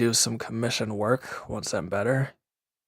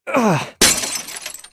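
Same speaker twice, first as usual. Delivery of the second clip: sharp high breathy sigh, louder; exasperated sigh; 12 semitones higher, 5 dB louder than usual. Acted. After something breaks, an exasperated sigh. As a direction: let out a frustrated huff.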